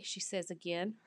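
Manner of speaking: ordinary delivery, nothing marked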